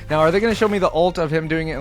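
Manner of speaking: ordinary delivery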